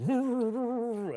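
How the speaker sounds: high muffled wail, falling at the end; long high muffled protest; muffled struggling cry. Acted. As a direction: imitate someone gagged being dragged off. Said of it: "begin to hear struggling, muffled, like".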